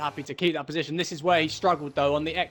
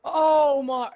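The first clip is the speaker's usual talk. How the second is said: surprised; 10 semitones higher, 7 dB louder than usual. Real, not acted. Co-commentator reacts to crash.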